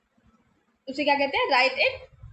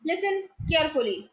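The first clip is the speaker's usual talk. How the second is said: slow confident; 2 semitones higher, much slower than usual. Shown as a demonstration. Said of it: "बहुत ही confidence के साथ".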